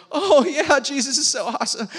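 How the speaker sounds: put-on high, loud, gushing camp-kid voice with a laugh; mock the shallow hype, laughing. Youth pastor mocking camp hype, gushing. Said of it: "the hype of like".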